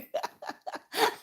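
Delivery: airy high giggle; breathy high laugh. Real, not acted. Artist still giggling at the comment, airy laugh.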